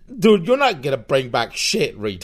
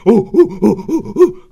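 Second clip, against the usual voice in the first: monkey hoots; 4 semitones higher, 7 dB louder than usual. Pretend. Monkey noises.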